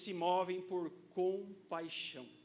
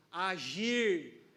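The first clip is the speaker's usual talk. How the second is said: ordinary talk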